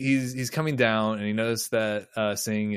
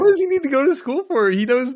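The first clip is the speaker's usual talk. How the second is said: much higher, louder, fast, disbelieving; incredulous; 14 semitones higher, 5 dB louder than usual. Real, not acted. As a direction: fire off the question in disbelief. Incredulous rant about Wesley.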